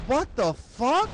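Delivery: drawn-out, high-pitched outrage; incredulous. Retold or imitated. Boyfriend learns she delivers pizza, slow incredulous outburst. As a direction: stretch it out in mock disbelief. Boyfriend shocked at girlfriend's job.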